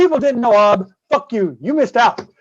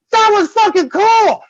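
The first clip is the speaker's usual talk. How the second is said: higher and slower, full of delight; thrilled; 10 semitones higher, 3 dB louder, slower than usual. Retold or imitated. Ob grinning at the broken gnome, higher and slower with delight. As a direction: savour every word like it's the best thing ever.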